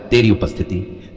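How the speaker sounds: ordinary delivery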